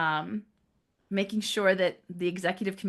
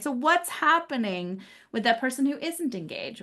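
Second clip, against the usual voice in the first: usual speaking voice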